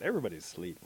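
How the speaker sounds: ordinary delivery, nothing marked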